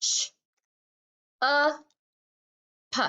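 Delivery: "Ch, uh, p" one sound at a time. Shown as a demonstration. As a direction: separate "Ch", "uh" and "p" with long pauses. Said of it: "One more time".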